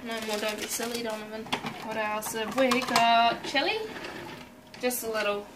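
ordinary talk, nothing marked

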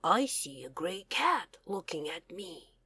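hushed whisper for the quiet cat; soft storybook whisper. Acted. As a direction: breathe it out like a secret.

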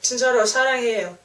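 ordinary talk, nothing marked

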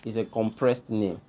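normal talking voice

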